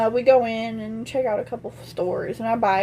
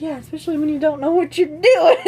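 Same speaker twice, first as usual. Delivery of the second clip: rising louder into a laugh; laughing reply; 5 semitones higher, 5 dB louder than usual. Retold or imitated. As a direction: crack up as the line ends. Joking reply, breaking into laughter.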